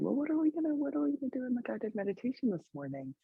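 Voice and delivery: high, soft, quick asking voice; a hint of an eager student asking; mock student question. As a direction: lightly play a curious student. Quoting students' morning question.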